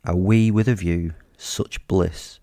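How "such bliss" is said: ordinary delivery, nothing marked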